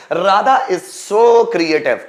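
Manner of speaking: very slow, each word spaced; slow dictation. Shown as a demonstration. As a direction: read it out slowly for students to write. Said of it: "teacher dictates example sentence".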